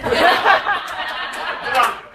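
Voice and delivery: a real burst of laughter; laughing aloud. Real, not acted. Storyteller laughing at her own tale. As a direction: laugh at the joke.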